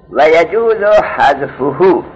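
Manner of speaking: slow, loud recitation of the Arabic text; reciting the text. Shown as a demonstration. Teacher reading the Arabic book line aloud to students, slow and loud. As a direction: read each word out slowly and firmly. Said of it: "حالا عبارت گوش بدید".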